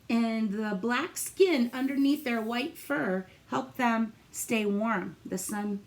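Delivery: ordinary talk, nothing marked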